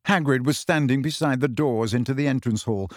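plain reading voice

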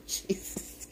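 whispered laughing Jesus, very high; breathless laugh. Real, not acted. Preacher catches herself, laughing in a whisper. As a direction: laugh it out under your breath. Breaks off mid-sermon laughing.

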